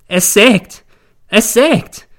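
high, loud wail. A narrator's reading. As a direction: cry it out in hysterical lament. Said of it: "jammert sie". (laut und hoch jammern)

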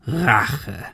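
scornful villain echoing the word; mocking, falling exclamation; hoarse gunman. A narrator's reading. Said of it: "Der Mann im Nebel lachte heiser".

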